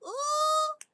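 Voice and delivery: high, held ooh; thrilled pet owner's ooh; excited high ooh. Real, not acted. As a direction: squeal with delight. Excited reaction.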